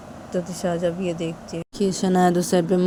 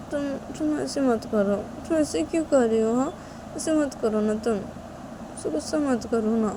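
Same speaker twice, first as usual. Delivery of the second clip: coaxing Shanaya; 9 semitones higher, 3 dB louder than usual. Acted. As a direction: gently coax a cross friend to make up.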